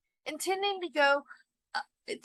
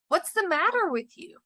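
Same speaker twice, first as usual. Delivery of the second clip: faltering; 8 semitones higher, 7 dB louder than usual. A narrator's reading. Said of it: "he faltered".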